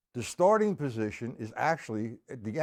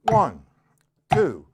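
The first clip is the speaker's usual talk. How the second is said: loud, separated counting locked to the beat; quarter-note count; 9 dB louder, much slower than usual. Shown as a demonstration. Drum teacher counting along with the metronome, loud steady count. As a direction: call out each number firmly in slow, even time. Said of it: "with quarter notes".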